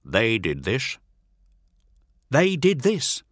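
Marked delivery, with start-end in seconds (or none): 2.3-3.2 higher, louder nervous echo; Teth agreeing. Acted. Teth nervously confirming to his master. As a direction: echo it back, anxious. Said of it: "agrees Teth".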